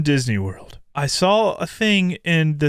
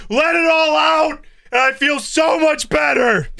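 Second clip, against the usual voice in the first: mock therapy shout; mock cathartic outburst; 11 semitones higher than usual. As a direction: belt it out like finally letting it all go. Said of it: "I'm exaggerating a shit ton".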